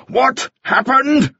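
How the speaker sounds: slow, high-pitched, insistent question; urgent demand. A narrator's reading. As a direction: draw out each word, demanding news. Master demands news of his ship.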